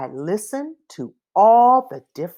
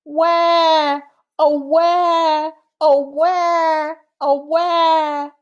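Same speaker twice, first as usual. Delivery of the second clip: high, louder playful wah, oh, wah; mock baby crying; 10 semitones higher, 3 dB louder, much slower than usual. Shown as a demonstration. Reading to a child, mimicking a crying baby, high and hammed-up. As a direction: wail like a baby, but playfully. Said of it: "A baby crying".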